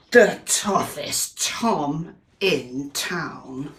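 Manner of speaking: very slow, heavy delivery; slow and ominous. Acted. Introducing the tough cat to a child, slow and ominous. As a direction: stretch every word to make him sound menacing.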